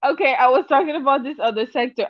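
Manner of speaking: normal talking voice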